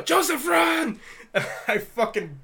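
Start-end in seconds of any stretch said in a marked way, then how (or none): 0.0-1.0 high, loud imitated shout of alarm; retold yell. Dad sending his son running, mock panicked yell. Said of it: "he would just yell".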